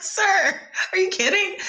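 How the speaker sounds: can't believe what he just said; stunned disbelief. Retold or imitated. Actress stunned by her famous costar's praise, high incredulous squeal.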